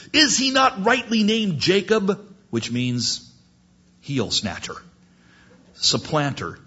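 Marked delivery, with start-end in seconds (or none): 0.1-2.2 high-pitched acted indignation; bitter complaint. Cheated Esau complaining of Jacob, high indignant cry. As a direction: protest it, pitched well up.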